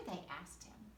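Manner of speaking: ordinary delivery, nothing marked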